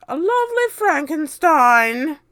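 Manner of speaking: a spiteful witch setting up the insult; Aunt Spiker. A narrator's reading. Aunt Spiker mocking her sister, high and loud. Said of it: "Aunt Spiker said".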